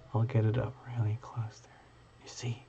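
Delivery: whispered aside, unvoiced; soft whisper, leaning close to the camera. Real, not acted. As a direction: breathe it under your voice. Whisper while showing card.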